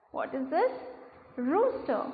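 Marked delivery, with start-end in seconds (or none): none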